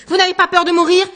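loud and high, rising in rage; furious challenge. Acted. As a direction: spit it at him, enraged. Anguste threatens in rage. (Anguste furieux, le saisissant au col)

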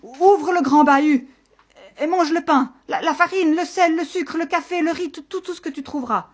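high, loud, stammering desperation; panicked shout. Acted. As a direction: blurt the list in panic, speeding up at the end. Desperate shepherd yields everything to monster. (panique, voix aiguë et forte)

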